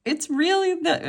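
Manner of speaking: high, giddy fan voice; retold fan excitement. Fan retelling the team walking out, high and starstruck.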